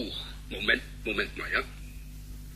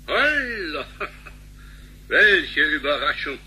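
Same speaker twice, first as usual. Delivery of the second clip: surprised greeting; 4 semitones higher, slower than usual. Acted. Unexpected callers on videophone.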